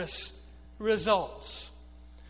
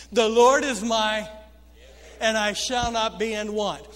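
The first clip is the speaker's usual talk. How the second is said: much louder proclaimed verse; preached scripture; 6 dB louder than usual. Acted. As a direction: declaim it to the congregation. Quoting scripture with force.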